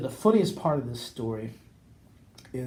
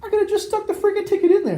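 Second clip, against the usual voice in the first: much higher, fast frustrated rant; exasperated outburst; 5 dB louder, faster than usual. Real, not acted. Telling the cohost about his own lost ticket, exasperated and high. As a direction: blurt it out, kicking yourself.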